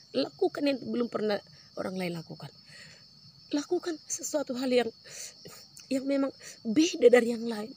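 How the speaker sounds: preaching with force. Acted. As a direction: urge the congregation on, building. Preacher exhorting the congregation. (khotbah berapi-api)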